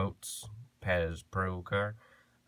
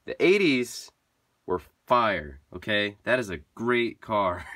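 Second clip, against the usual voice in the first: loud, higher-pitched insistence; emphatic fan rant; 3 semitones higher, 7 dB louder than usual. Real, not acted. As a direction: hammer each verdict home with pauses. Emphatic rant defending the eighties.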